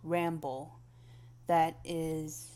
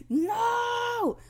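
1 alarmed cry; 7 dB louder than usual. Real, not acted. Dismay at starting again.

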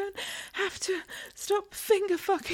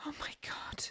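softer, all-breath whispered gasps; breathless whisper; 9 dB quieter, faster than usual. Acted. Catgirl in the window, whispering in awe to her partner. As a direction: whisper it as if overcome.

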